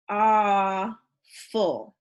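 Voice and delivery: "Awful" split into two slow, held syllables, aw, ful. Shown as a demonstration. Teacher counting syllables for class, "Awful" broken slowly in two. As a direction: stretch "Awful" and pause between aw and ful.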